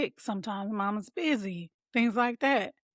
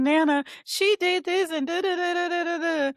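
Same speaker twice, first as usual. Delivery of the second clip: put-on high, louder tattling child voice; imitating her son; 9 semitones higher, 4 dB louder than usual. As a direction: play the kid rushing in to tell on his sister. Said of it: "He's like".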